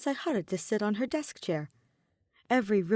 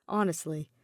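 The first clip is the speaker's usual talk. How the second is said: ordinary delivery, nothing marked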